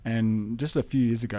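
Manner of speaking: ordinary talk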